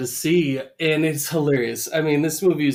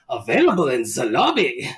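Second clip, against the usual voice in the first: lilting mock sales tag, a little louder; dead Nazi pitchman; 4 dB louder than usual. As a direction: play a corpse chirping an ad line. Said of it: "the dead Nazi wakes up and goes".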